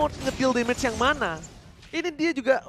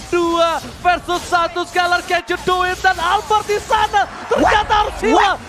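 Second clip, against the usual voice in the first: loud, high hype call; esports caster shout; 5 semitones higher, 4 dB louder, slower than usual. Real, not acted. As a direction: shout the triple kill. Caster calling triple kill. (teriakan komentator esports)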